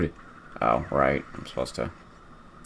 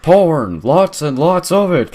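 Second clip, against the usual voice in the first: shouting; 12 semitones higher, 9 dB louder, slower than usual. Acted. Shouting a joke answer.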